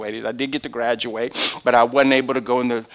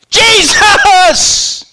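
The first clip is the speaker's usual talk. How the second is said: high, loud desperate shout; desperate cry; 10 dB louder than usual. Retold or imitated. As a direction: cry out over the crowd. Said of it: "He begins to cry out".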